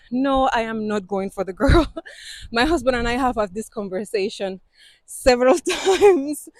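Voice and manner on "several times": laughing. Real, not acted. Laughs over husband conversation.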